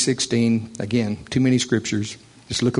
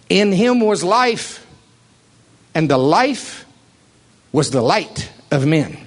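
preaching; 5 semitones higher than usual. Acted. Reciting scripture, high and slow.

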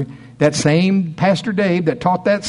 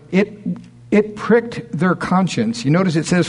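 ordinary talk

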